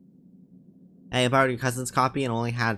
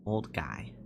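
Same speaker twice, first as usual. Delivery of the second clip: put-on sinking low, soft old-man voice; tired old guy; 9 semitones lower, 8 dB quieter than usual. Shown as a demonstration. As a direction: let it drop into a weary old man. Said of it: "I need, like, a tired voice".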